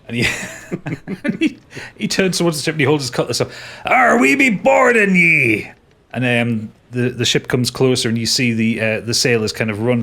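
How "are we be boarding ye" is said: slow pirate rallying cry, louder and higher; hammed-up pirate boarding call; pirate captain. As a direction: raise the cutlass and roar it out slowly. Captain brandishes his cutlass.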